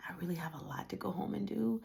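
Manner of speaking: fast, breathy whisper of a worn-out excuse; tired whisper. Retold or imitated. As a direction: whisper it quickly, drained. Tired excuse to friend Chris.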